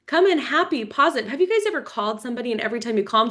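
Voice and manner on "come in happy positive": normal talking voice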